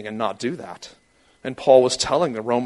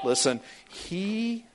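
voice cracking; 9 semitones higher, 4 dB quieter, much slower than usual. Real, not acted. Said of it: "I just pegged out the system".

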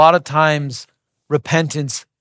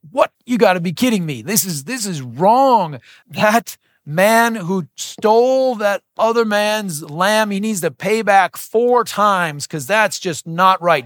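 higher, indignant outrage, slowing on the key words; David outraged at the rich man's theft; incensed; 5 semitones higher than usual. Retold or imitated. David hearing Nathan's story, indignant and outraged. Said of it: "is incensed".

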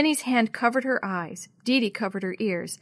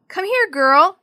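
loud, high coaxing call; 9 semitones higher, 10 dB louder, slower than usual. A narrator's reading. Jenny to her lost cat, calling out. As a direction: summon a pet from afar. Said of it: "she called".

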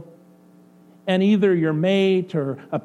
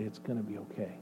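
much softer, soothing reassurance; comforting; 7 semitones lower, 15 dB quieter, faster than usual. Acted. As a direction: soothe a crying friend. Comforting someone who broke down.

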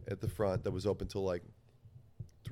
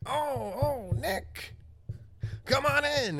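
high, wailing, loud welcome; addled dealer; 5 dB louder, slower than usual. Retold or imitated. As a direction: mimic a spaced-out man hollering hello. Imitating addled dealer welcoming him.

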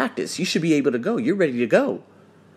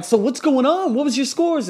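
high, excited asking; eager questions; 10 semitones higher than usual. Retold or imitated. Asking students who just passed, eager and high. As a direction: bounce up with eager curiosity.

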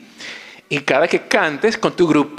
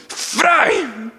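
excited call; 10 semitones higher than usual. Retold or imitated. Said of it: "Esa noche que me dijo".

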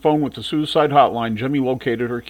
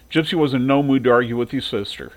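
usual speaking voice

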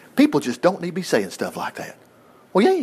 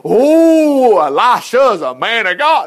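high, loud, slow gushing mock awe; 3 semitones higher, 5 dB louder, much slower than usual. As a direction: send up a breathless fan. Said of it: "it's not to go".

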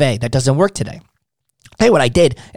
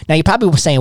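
ordinary talk

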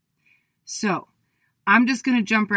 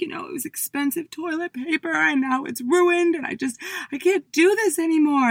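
distraught whine; 9 semitones higher than usual. Retold or imitated. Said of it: "I started bawling my eyes out".

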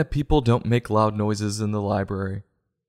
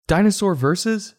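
Dinosaur versus asked much higher with a rising end; baffled question; 7 semitones higher than usual. Real, not acted. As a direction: throw the words back in disbelief. Repeats the book's quote baffled.

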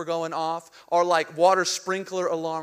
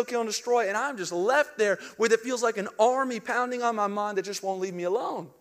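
ordinary talk, nothing marked

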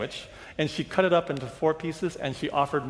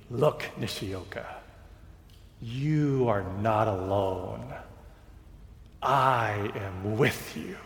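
voice of God; 6 semitones lower, 3 dB quieter, slower than usual. Acted. God speaks to the preacher.

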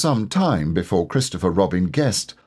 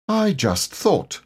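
slow, higher, shy little bear; lightly voiced Pooh; 8 semitones higher, slower than usual. A narrator's reading. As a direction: Pooh timidly offering his idea.

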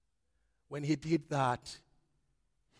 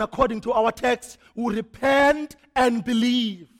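full-force preaching; 7 semitones higher, 13 dB louder than usual. Acted. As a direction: drive each phrase home to the congregation.

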